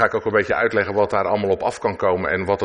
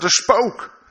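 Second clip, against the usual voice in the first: much higher, louder scared cry of Een geest; frightened cry; 13 semitones higher, 4 dB louder than usual. Acted. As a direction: shriek it like terrified disciples. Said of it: "schreeuwden het uit van angst". (bange leerlingen roepen in paniek naar de geest)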